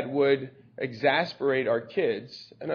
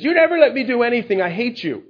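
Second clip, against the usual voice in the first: put-on shrill, fast, loud teenage yell; a daughter screams at her father over being refused a party; teen's angry outburst; 8 semitones higher, 5 dB louder than usual. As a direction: hurl it at Dad like a furious seventeen-year-old. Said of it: "And she says".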